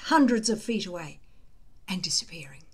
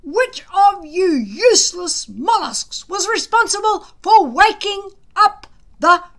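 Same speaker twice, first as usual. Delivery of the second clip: exploding at them; 9 semitones higher, 9 dB louder than usual. A narrator's reading. Gobber tearing into the shivering boys, furious bellow. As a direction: roar at the shivering boys, high and loud. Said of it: "he exploded all over the place".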